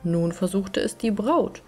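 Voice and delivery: plain reading voice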